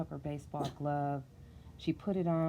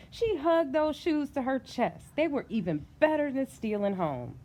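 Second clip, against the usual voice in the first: excited narration; 8 semitones higher, 4 dB louder than usual. A narrator's reading. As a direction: savour the joy. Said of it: "so excited she could barely".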